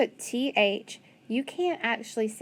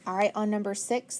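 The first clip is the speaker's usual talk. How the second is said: ordinary talk, nothing marked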